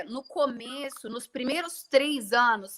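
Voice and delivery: ordinary delivery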